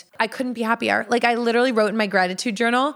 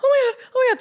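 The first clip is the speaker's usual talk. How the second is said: very high excited squeal; retold giddy freak-out, pitched high; 4 dB louder, faster than usual. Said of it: "So I'm freaking out".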